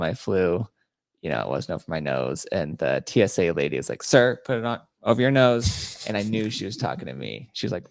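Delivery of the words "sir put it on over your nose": lightly voiced officious TSA agent, a bit louder; mildly mock an official's command; retold stern order.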